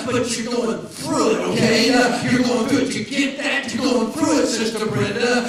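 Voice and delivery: loud, slow, driving sermon cadence; full-force preaching. Acted. Pastor preaching at full volume to the congregation.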